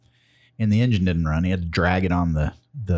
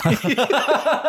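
burst of laughter. Real, not acted. Laughing at own story.

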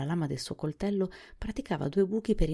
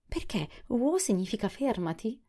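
higher-pitched, puzzled question; surprised; 7 semitones higher than usual. A narrator's reading. Sawhorse questions the boy about the command, surprised. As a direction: ask it in open surprise. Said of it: "con voce sorpresa".

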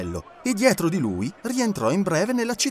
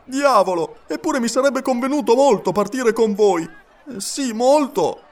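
higher and louder, slowing to a regretful close; disappointed Passepartout; 9 semitones higher, 4 dB louder than usual. A narrator's reading. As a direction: sigh out the lost chance.

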